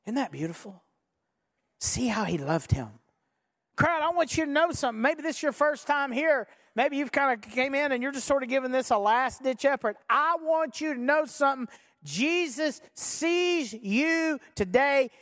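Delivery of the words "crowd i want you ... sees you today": raised-pitch, earnest appeal, slowing at the end; impassioned preaching. Real, not acted. Preacher appeals to first-time visitors, impassioned preaching. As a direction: press the message home to each listener.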